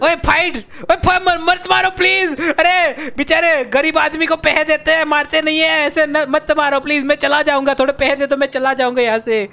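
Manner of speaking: high, urgent begging; mock panicked pleading. Broke gamer begs attacker to stop hitting, panicked pleading. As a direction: beg for mercy in hammed-up panic.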